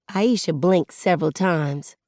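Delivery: usual speaking voice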